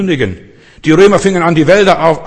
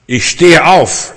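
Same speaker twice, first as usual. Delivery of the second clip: slow, raised, emphatic declaration; firm resolve; 4 semitones higher, 6 dB louder, much slower than usual. Acted. Believer deciding to leave bed in the morning, slow firm resolve. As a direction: proclaim it as a determined decision, drawn out.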